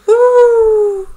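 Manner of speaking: high, loud whooshing wind noise; howling wind. Acted. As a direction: howl like the winter wind. Imitating wind in the wood.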